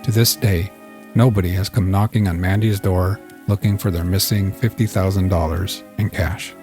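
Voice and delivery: ordinary delivery, nothing marked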